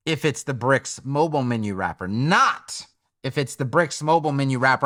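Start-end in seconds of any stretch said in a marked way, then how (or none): 2.1-4.9 "not" punched out, then a pause before the rest; stress on NOT. Shown as a demonstration. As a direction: hit "not" hard and let it hang. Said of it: "I'm stressing the word not".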